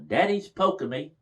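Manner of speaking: imitating a little girl hollering, high-pitched; mock child's holler. Little daughter tattles on her teasing daddy to grandma, mock holler. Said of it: "she hollered".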